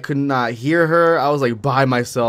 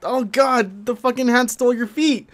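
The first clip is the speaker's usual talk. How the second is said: high, breathless horror; panicked; 11 semitones higher than usual. Retold or imitated. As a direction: blurt it out in disbelief, pitched way up. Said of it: "'cause I was like, Oh my god".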